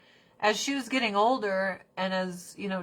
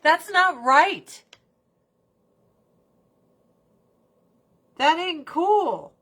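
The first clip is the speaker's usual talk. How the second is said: slow, higher, emphatic scolding; indignant; 8 semitones higher, 6 dB louder, slower than usual. Retold or imitated. Speaker tells the Dodgers off, slow indignant scolding. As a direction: lay it out slowly, disappointed. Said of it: "and I'm like".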